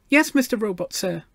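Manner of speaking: put-on high, earnest little girl; treble child voice. A narrator's reading. Gloria describes her robot friend to the machine, earnest treble. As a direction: explain it in a small, high, polite voice. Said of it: "she raised her treble voice".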